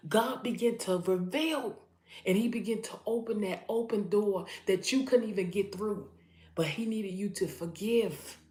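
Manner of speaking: loud, high, forceful preaching; impassioned preaching. Real, not acted. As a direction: drive it home at full voice. Preacher peaks on breakthrough message.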